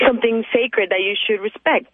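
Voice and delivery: ordinary delivery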